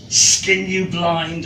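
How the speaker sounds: ordinary delivery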